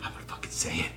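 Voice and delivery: whispered, slurred defiance; drunk whisper. Retold or imitated. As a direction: whisper it like a tipsy dare. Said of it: "you were drunk and you're like".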